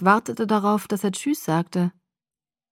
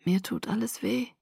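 weak, much softer voice in pain; 2 semitones higher, 8 dB quieter than usual. A narrator's reading. Sick boy says he aches all over, pained. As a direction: let the hurt drain the voice. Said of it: "Der Schmerz in seiner Stimme".

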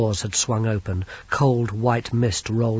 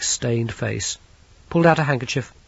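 plain reading voice

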